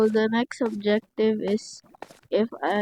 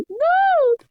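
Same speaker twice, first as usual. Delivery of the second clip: laughing yell; 4 dB louder than usual. Real, not acted. Laughs at dying again.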